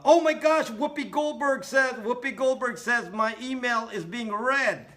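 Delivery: high, loud, hammed-up shocked voice; mock panic. Mocking a viewer shocked about the NSA, high hammed-up panic. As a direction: shriek it like a hysterical fan. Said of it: "somebody's gonna tell me now".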